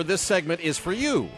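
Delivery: ordinary talk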